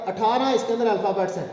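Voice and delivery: normal talking voice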